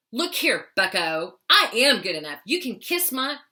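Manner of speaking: feisty talking-back to an inner bully; stern. Acted. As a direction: act out a punchy, stern telling-off. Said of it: "it was like a real stern".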